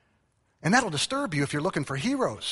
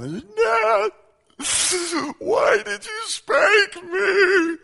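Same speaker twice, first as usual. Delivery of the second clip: put-on high, loud wailing kid, dragged out slowly; child sobbing at dad from the back seat; crying child; 6 dB louder, much slower than usual. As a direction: wail it like a spanked little kid.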